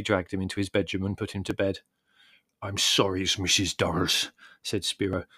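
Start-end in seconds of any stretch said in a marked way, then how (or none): 2.6-4.3 deep voice thickened with wine; a boozy, low-voiced apology; thick deep voice. A narrator's reading. Spiro apologising to Mrs Durrell, deep and wine-thickened. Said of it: "his deep voice thickened with wine".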